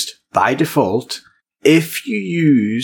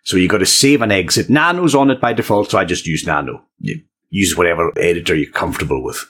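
ordinary delivery

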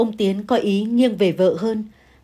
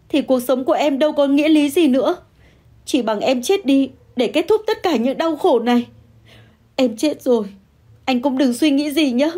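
sobbing; 5 semitones higher than usual. Acted. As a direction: sob out a threat of suicide. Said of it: "Hà vừa khóc vừa nói". (vừa khóc vừa nói, giọng cao đầy tuyệt vọng)

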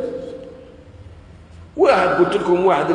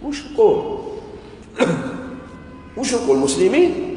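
puzzled questioner; 6 semitones higher than usual. Retold or imitated. Preacher voices a puzzled questioner.

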